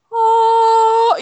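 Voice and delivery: high, loud delighted oh; excited oh at cute stuff; delighted gasp. Retold or imitated. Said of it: "look around going".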